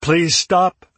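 begging. A narrator's reading. Narrator begs Hayden to stop the beating, begging. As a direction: cry out, pleading for it to end. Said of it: "I begged".

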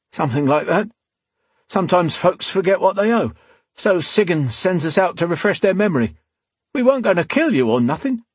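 high-pitched, strained henchman voice; cornered thug pleading his case; thug, defensive. Acted. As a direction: explain yourself hastily to your captor.

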